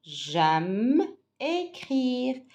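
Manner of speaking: J'aime écrire stretched very slowly, écrire held long; teacher modelling at a crawl; slow model sentence. Shown as a demonstration. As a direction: drag each syllable for the class to echo. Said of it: "Alors, avec moi".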